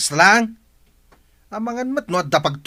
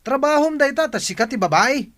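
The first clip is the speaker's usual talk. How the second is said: high, heated shout at his wife; husband yelling at his wife to do the chores, high and angry; 10 semitones higher than usual. Acted. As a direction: snap at her like she owes you. Husband yells at wife over chores.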